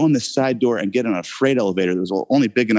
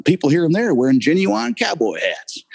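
higher-pitched, hammed-up Southern accent; Southern commercial accent; 7 semitones higher than usual. Actor saying his cowboy commercial line, put-on Southern accent. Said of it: "my line in the, in the commercial is".

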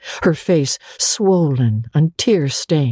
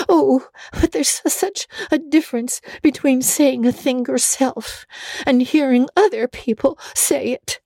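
put-on high, tearful girlish wail; a hurt child pleading her case; 13 semitones higher than usual. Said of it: "wailed Anne".

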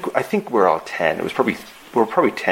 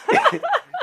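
high burst of laughter. Real, not acted. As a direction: crack up mid-story. Laughing at own story.

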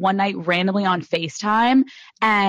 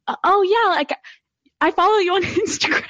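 high, halting, laughing re-enactment; awkward word vomit; 9 semitones higher than usual. Retold or imitated. Host retelling her blurted opener to a star, awkward high voice. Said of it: "I word vomited".

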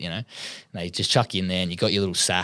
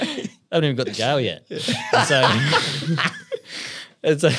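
laughing; 8 semitones higher, 6 dB louder than usual. Real, not acted. Laughing at his own story.

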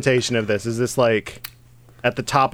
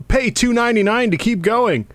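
high-pitched mock game announcement; put-on high voice of a game demanding money; perky game prompt; 10 semitones higher than usual. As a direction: pitch it way up like a pushy app pop-up. Voicing the game's payment prompt.

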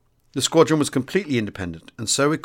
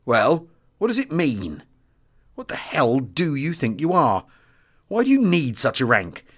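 belligerent; 3 semitones higher, slower than usual. A narrator's reading. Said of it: "he went on belligerently".

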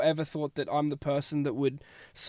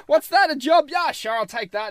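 breezy, much higher, louder "sure, why not" voice of his past self; carefree self; 9 semitones higher, 5 dB louder than usual. Retold or imitated. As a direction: toss it off like nothing matters. You've heard me just kind of go with the flow.

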